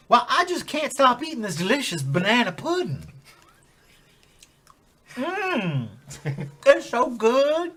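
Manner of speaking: high, loud Southern-belle-like voice; hammed-up sweet lady voice; high character voice. Put-on character voice.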